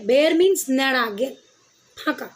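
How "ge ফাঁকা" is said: normal talking voice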